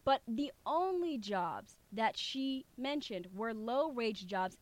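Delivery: ordinary talk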